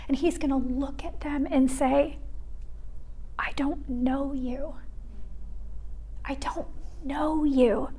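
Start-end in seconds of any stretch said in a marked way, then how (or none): 3.4-7.9 soft, grave whisper; whispered verdict. Retold or imitated. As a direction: deliver it hushed and heavy. Voicing Jesus' judgment.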